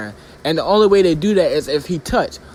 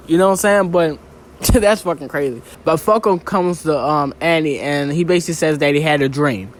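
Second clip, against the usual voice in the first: ordinary talk, nothing marked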